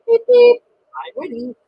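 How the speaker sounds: loud high toy-engine beeps; little engine tooting ready; cheery whistle beep. A narrator's reading. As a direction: toot it out brightly. Edward whistles.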